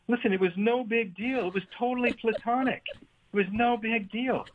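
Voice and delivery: high, insistent mock defence; defensive Jack. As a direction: brush it off insistently. Jack defending himself, retold.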